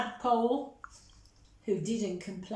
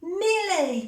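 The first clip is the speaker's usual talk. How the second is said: much higher, louder shocked call; cried out; 9 semitones higher, 7 dB louder than usual. Acted. Monty finding Millie behind his trick, crying out. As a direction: call out her name in dismay. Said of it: "cried Monty".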